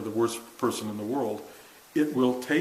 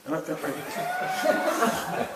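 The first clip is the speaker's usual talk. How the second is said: laughing; 10 semitones higher, much slower than usual. Real, not acted. Laughing at herself.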